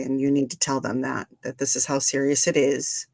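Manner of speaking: usual speaking voice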